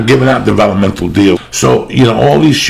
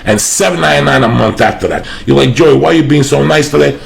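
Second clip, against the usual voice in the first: higher, louder pitchman plugging sponsors and shows; hyped ad read; 5 semitones higher, 3 dB louder than usual. Acted. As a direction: sell it like a radio ad. Reads a sponsor ad and show plugs.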